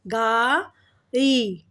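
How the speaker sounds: गाड़ी split into gaa and ree; drawn-out syllables. Shown as a demonstration. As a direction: stretch both vowels of गाड़ी. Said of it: "गाड़ी में भी ई का sound आ रहा है".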